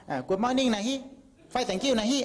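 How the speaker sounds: English greeting with a Rayong lilt and นะฮิ; light Rayong dialect English; Rayong accent. Shown as a demonstration. Speaker greeting a foreigner in English, light Rayong accent. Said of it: "เอาเป็นภาษาระยองไหม".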